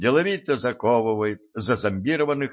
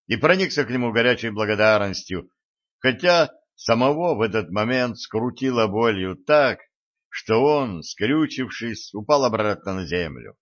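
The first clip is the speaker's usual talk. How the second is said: ordinary delivery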